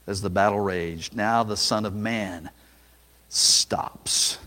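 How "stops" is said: breathy whispered word; whispered pause. Acted. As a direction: drop to a whisper for effect. Dramatic hush.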